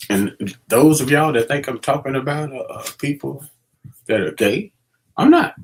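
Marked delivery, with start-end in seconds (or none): 5.2-5.5 emphatic denial. Real, not acted. Insisting he's not.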